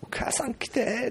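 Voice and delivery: かなり高く、ゆっくり伸ばして母を呼ぶ真似; か細く高い子供の呼び声をまねる; 夜泣きする子供. Retold or imitated. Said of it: "って泣いてんねんけどさ". (夜泣きの子をまねて高く伸ばす)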